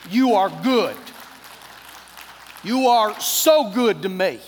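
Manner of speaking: loud, deliberate thanks; emphatic grateful praise. Retold or imitated. Preacher praising God for the favor, loud and emphatic. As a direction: build to slow, loud praise. Repeats praise louder to congregation.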